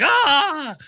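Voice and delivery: a sudden high yelp, imitating Ace Ventura; a high, startled cry like someone just hit by a dart; mock yelp of shock. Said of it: "He's like".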